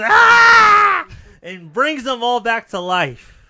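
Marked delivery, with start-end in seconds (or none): 0.0-1.1 sudden high full-voice scream; loud scream. Retold or imitated. Witch harnessing the moon, screaming out loud. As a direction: let out a huge high scream as the power hits. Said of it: "like, screaming".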